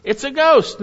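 high, loud outcry; preacher acting out a fearful shout; acted cry of fear. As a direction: cry it out as if frightened. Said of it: "they cried out for fear".

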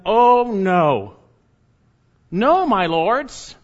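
loud, high, drawn-out protest; pleading refusal. Acted. Lot refusing the angels' command to flee, loud slow plea. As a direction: beg off slowly, as if horrified.

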